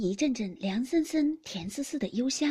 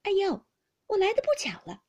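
put-on higher, louder young lady's voice, arch; teasing laugh; 9 semitones higher, 6 dB louder than usual. A narrator's reading. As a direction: needle him with a sly laugh. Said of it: "便笑道". (黛玉带笑的俏皮语气)

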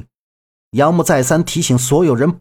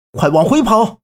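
urgent shout; 5 semitones higher than usual. A narrator's reading. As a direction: shout the order to run back. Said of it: "就冲周震他们大喊".

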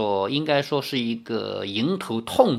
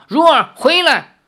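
much higher, loud shout; calling her back; 12 semitones higher, 7 dB louder than usual. A narrator's reading. Father shouts for his daughter to come back, loud. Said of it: "林天南叫道".

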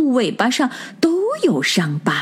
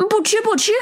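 much higher, fussy frowning refusal; 13 semitones higher, faster than usual. A narrator's reading. Rabbits refusing the bear judge's meat, a higher fussy frown. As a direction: pout and refuse. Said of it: "皱着眉头说".

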